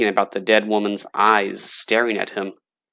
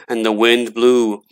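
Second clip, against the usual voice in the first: ordinary delivery, nothing marked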